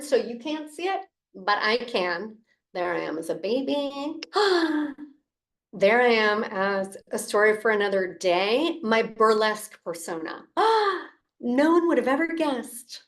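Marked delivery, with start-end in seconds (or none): 4.2-5.0 sharp delighted intake of breath; mock-shocked gasp; playful gasp. Mock surprise at old photo. 10.5-11.1 a sharp, much higher gasp of mock scandal; delighted mock-scandalized gasp; high playful gasp. Mock shock at burlesque persona.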